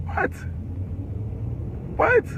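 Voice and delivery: high, incredulous questioning. Real, not acted. Arrested teen questions a wasted future, incredulous. Disbelief at arrest being for nothing.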